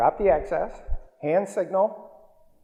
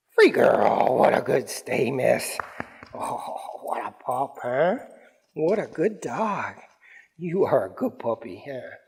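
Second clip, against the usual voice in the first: doting dog praise; 4 semitones lower than usual. Real, not acted. Trainer to dog after a good stay, doting praise.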